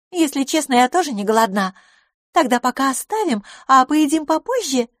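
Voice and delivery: put-on high-pitched, lively young lady; light-hearted female character. A narrator's reading. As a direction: chatter easily in a lifted voice. Narrator voices Miss Lafosse. (высокий голос беззаботной героини)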